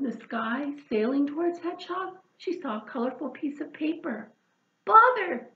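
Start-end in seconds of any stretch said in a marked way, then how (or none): 4.8-5.5 high, loud exasperated cry; cried bother. Acted. Hedgehog seeing the paper land, loud high exasperated cry. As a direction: blurt it out in frustration. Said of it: "she cried".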